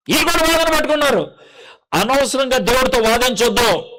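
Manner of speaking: ordinary talk, nothing marked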